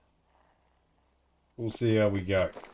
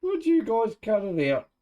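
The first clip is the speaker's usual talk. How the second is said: raised, high calling out; 10 semitones higher, 4 dB louder than usual. Real, not acted. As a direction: call across the pen to stop them. Yelling at the animals.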